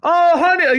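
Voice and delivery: high, loud mock girlfriend. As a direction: squeak it like a girlfriend.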